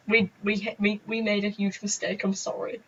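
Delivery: sheepish apology. Retold or imitated.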